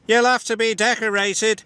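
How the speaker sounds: high-pitched engine character voice; James's voice. A narrator's reading. James telling Gordon about the decorations, high character voice. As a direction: pitch it well above the narrator. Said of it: "said James".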